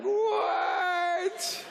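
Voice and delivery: very high, drawn-out disbelief; hammed-up astonishment; mock shocked shriek. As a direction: squeal it like a stunned passenger. Stunned passengers' reply acted out.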